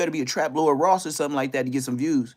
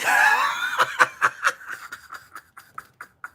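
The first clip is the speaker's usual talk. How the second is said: long, high cackling laugh. Real, not acted. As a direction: burst out in prolonged, mocking laughter. Laughs at those who missed.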